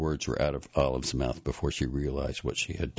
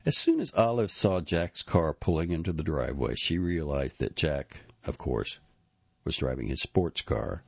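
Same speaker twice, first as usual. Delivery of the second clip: ordinary delivery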